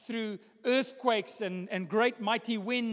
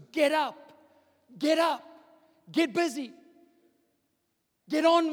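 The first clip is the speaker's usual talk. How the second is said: higher, pushing get up; urgent rousing; 6 semitones higher, 5 dB louder than usual. Acted. Preacher urging congregation to get up, urgent call. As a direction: spur them into action.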